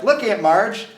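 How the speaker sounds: a retold burst of delighted teasing; excited showing-off. Niece or nephew showing Aunt Marge a lookalike card, excitedly.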